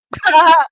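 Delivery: loud short laugh at her own story; burst of laughter. Real, not acted. Laughing loudly while telling viewers about the blunt.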